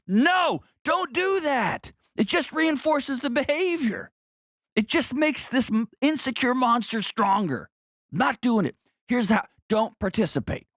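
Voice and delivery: mock outrage. Mocking the advice.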